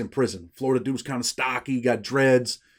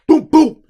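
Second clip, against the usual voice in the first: punch sounds; 12 dB louder than usual. Retold or imitated. Acting out two punches landing.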